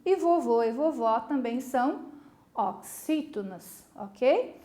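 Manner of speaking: ordinary delivery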